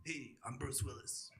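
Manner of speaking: a hint of a weary action hero, low-key; understated Bruce Willis murmur. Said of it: "name a famous person".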